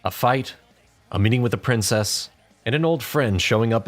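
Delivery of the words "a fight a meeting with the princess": ordinary delivery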